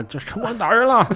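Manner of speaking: high-pitched mock cry for bystanders; feigned helpless victim. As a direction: play a scammer wailing to the crowd. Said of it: "装作孤寡可，可怜老人".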